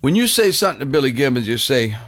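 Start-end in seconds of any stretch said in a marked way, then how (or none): none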